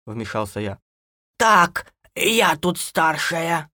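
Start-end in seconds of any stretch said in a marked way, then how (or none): 1.4-3.7 loud, slow, high granny; brushing him off. A narrator's reading. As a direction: assert seniority, high and drawn out. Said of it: "отмахнулась хозяйка". (бабушка властно отмахивается)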